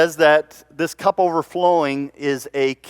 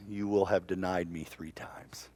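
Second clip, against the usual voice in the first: hushed prophecy; 5 semitones lower, 10 dB quieter than usual. Acted. Jesus foretells Peter's denial.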